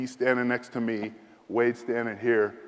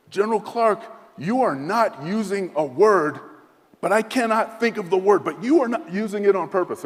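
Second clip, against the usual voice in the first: indignant general; 8 semitones higher, 5 dB louder than usual. Retold or imitated. General berating the American for avoiding a word, higher and louder. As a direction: scold him as the offended general.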